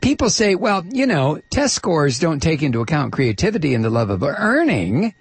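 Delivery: high-pitched imitation of people she disdains; mock them in a sugary, grating high voice; drippy, grating mockery. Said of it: "she says with a drippy, grating voice".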